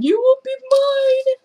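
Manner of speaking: high squealing claim on the dog; squealed claim. Real, not acted. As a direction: squeal it like a promise. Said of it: "second take, squealed".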